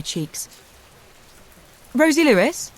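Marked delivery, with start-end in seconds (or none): 1.9-2.7 loud, high questioning call; a stranger calling a name across a driveway. A narrator's reading. Social worker calls out to the carer across the street, loud and high. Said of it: "she called out".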